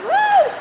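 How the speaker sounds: very high, loud "woo"; excited whoop. Retold or imitated. Daughter cheering along on the raft, high whoop. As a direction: whoop along with mock enthusiasm. Teller joins the mother's whooping.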